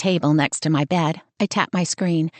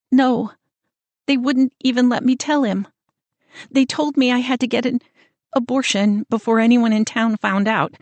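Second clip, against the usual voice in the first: upset sister; 5 semitones higher than usual. Acted. Sister upset about parents' demand.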